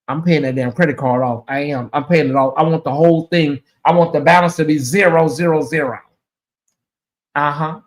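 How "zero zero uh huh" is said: ordinary talk, nothing marked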